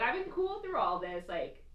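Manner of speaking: ordinary talk, nothing marked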